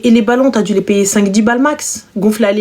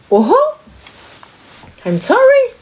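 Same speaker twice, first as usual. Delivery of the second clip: surprised; 12 semitones higher, slower than usual. Real, not acted. Startled exclamation.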